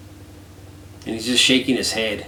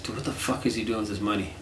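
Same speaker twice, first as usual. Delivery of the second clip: hushed aside; 3 semitones lower, 10 dB quieter than usual. Retold or imitated. Private thought aside.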